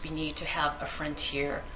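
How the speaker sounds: usual speaking voice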